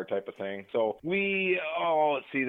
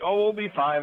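mock reassurance; 9 semitones higher, 4 dB louder than usual. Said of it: "everybody's like".

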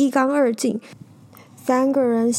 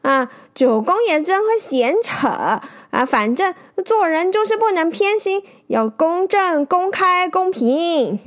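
higher, playful jab; teasing; 6 semitones higher than usual. A narrator's reading. Brother-in-law teases the old sailor, playful and higher. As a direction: tease him with a wink. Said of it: "挤眉弄眼说".

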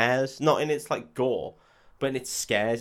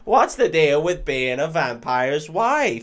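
loud, slow, raised-pitch setup; hammed-up stand-up comic; 4 semitones higher, 7 dB louder, slower than usual. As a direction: play a hack comic opening a bit. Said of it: "Open mic nights".

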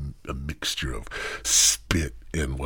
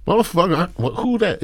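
high, loud startled yelp; 6 dB louder, faster than usual. Retold or imitated. Retelling being called by name, loud startled yelp. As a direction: jump out of your skin. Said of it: "I'm like".

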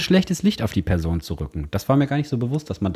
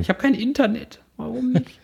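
breaking up space radio; 13 semitones higher than usual. Real, not acted. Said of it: "Ich hör dich doch".

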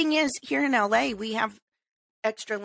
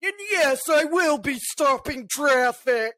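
entitled parker; 2 semitones higher than usual. Retold or imitated. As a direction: drag it out like a spoiled, self-important driver. Imitating entitled hazard-light parker.

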